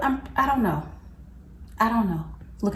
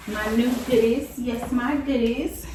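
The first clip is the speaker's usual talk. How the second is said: cooing over goodies; 5 semitones higher, slower than usual. Real, not acted. Showing off new bags.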